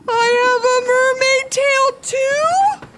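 very high, loud, drawn-out cry; high-pitched shocked squeal from mom; astonished shout. A narrator's reading. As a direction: shout in amazement, stretching every word. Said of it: "she shouted".

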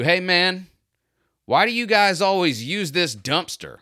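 lightly hammed-up shop owner picking a fight; confronting stranger. Shop owner confronting the pool cleaner, lightly voiced pushy challenge. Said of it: "he just immediately launches into".

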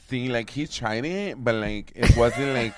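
loud Nuyorican woman sliding into Spanish; Suzanne. Acted. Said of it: "like, Puerto Rican".

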